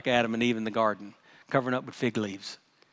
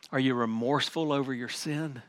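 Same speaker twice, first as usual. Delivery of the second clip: ordinary delivery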